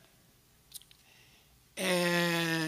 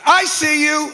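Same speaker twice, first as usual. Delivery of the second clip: loud and higher, snapping out at him; sudden yell; 9 semitones higher, 9 dB louder, slower than usual. Retold or imitated. The officer yells at the pastor at her desk, loud snap. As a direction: bark it out to catch him.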